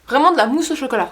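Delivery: thrilled over the chocolate-mousse texture; gushing. Real, not acted. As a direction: rave about it like dessert.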